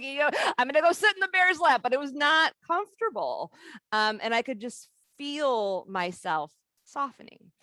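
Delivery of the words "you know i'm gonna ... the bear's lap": mock brave resolve. Bracing to sit in his lap.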